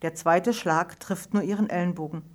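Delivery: ordinary delivery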